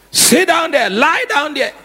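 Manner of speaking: a hint of a stern father, pitched high; stern parent. Preacher imitating a parent ordering a child, lightly mock-stern.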